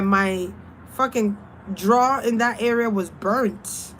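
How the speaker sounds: ordinary talk, nothing marked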